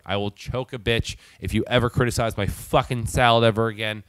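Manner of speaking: ordinary talk, nothing marked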